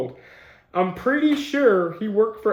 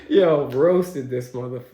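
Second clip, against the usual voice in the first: laughing; 2 semitones lower, slower than usual. Real, not acted. Reader reacts to a roast in the article, laughing. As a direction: break into laughter mid-sentence. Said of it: "I'm sorry I'm laughing".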